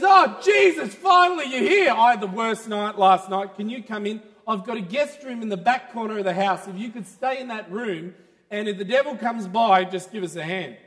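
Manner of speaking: high, loud, excited shout. Acted. Man greeting Jesus at the door, excited shouting. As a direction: burst out with relief and glee. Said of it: "He's so excited".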